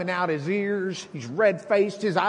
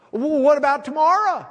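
higher, louder anxious question; worried objector; 10 semitones higher, 8 dB louder than usual. Retold or imitated. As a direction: fret like a worried churchgoer. Voices a worried believer.

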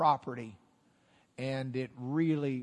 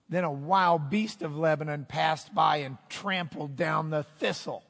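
trash talk; 6 dB louder than usual. Acted. King of Israel answering Amaziah, scornful trash talk. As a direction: put the upstart in his place, booming then dropping. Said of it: "here's his trash talk back".

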